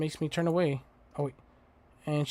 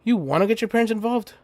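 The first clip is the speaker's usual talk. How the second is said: loud warning; 6 semitones higher, 5 dB louder, faster than usual. Acted. Protagonist warning Fang loudly.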